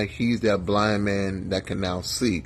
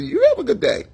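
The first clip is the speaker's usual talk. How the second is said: mock cheerful brush-off; 5 dB louder, faster than usual.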